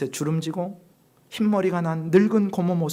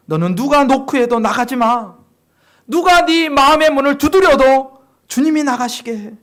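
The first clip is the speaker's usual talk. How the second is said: 높고 크게 힘주어 명하듯; 단호히 타이르며 목소리를 높여; 8 semitones higher, 10 dB louder than usual. Acted. 깨달음을 주는 말을 힘주어 극화. (높고 힘찬 목소리로 당부)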